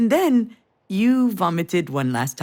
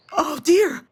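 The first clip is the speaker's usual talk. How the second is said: high, loud retold cry; mock scream, pitched up; imitated child's scream; 7 semitones higher, 5 dB louder than usual. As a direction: imitate a child crying out in alarm. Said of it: "you started screaming".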